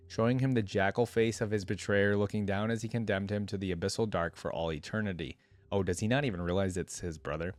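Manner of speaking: ordinary talk, nothing marked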